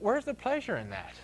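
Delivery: usual speaking voice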